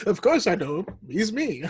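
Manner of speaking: lightly voiced Obi-Wan; a touch of wise old Jedi. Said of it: "that reminds me of Obi-Wan".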